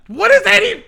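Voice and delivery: high, loud disbelief; can't believe what you're asked; shocked protest. Real, not acted. Protesting the Hawaiian accent request.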